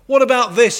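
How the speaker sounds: loud, high, sudden challenge; storyteller raising the voice in outraged protest; indignant demand. A narrator's reading. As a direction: demand it forcefully, pitched well up. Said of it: "he demanded".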